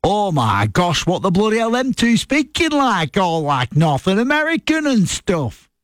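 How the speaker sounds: broad northern English, astonished, slow start; Uncle Sean's northern accent. Retold or imitated. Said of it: "his reaction was like".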